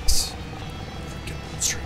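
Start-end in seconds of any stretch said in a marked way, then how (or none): 1.0-1.8 soft whispered trash talk; whispered taunts. Real, not acted. Gamer hiding from the monster, whispering taunts. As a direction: hiss the taunts under your breath.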